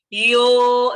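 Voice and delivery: "Yo" loud and sustained on one note; long held yo. Shown as a demonstration. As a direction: hold the vowel of "Yo" out long.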